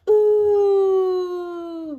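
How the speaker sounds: loud, high howling ooh like the wind; wind whoosh. A narrator's reading. Narrator howls the wind for the children, a loud ooh. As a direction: howl it out like a gusty wind. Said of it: "went the wind".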